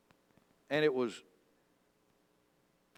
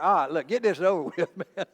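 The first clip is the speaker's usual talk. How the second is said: laughing fish voice; 4 semitones higher, 8 dB louder, faster than usual. Retold or imitated. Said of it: "He was just like".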